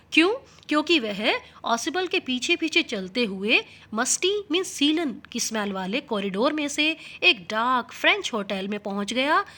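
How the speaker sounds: ordinary talk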